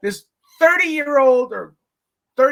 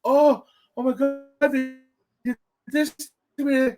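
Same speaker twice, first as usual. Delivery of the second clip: mock victim whine; 4 semitones higher than usual. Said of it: "would have played the victim".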